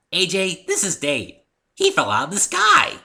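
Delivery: high, loud, proud and bubbly; eager pony showing off her find; Pinkie's voice. Acted. Pinkie proudly introduces Dave.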